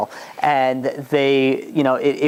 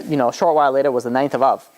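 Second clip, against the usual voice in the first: normal talking voice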